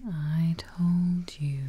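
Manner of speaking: normal talking voice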